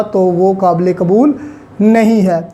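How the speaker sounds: ordinary talk, nothing marked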